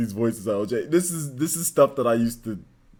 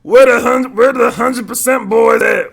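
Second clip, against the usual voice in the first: hyped shout; 10 semitones higher, 13 dB louder than usual. Pretend. Hyped loud voiced line.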